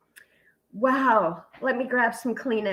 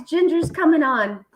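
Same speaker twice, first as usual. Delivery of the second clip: usual speaking voice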